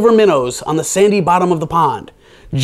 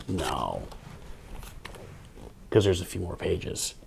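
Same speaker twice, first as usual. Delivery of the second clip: much lower and softer, bone dry; deadpan reply; 9 semitones lower, 18 dB quieter than usual. Real, not acted. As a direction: shrug it off dryly. Deadpan answer to Toad.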